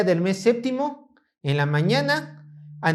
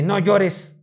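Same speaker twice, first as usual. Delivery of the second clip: stern governor. Acted. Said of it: "llega mi gobernador todo malo y me dice".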